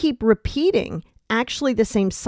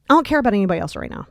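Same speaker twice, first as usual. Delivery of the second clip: ordinary talk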